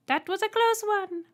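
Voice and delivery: very high, loud piercing voice; Trembler. A narrator's reading. Alien greeting the human, piercing high voice. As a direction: squeak it out politely. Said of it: "the one in the centre said".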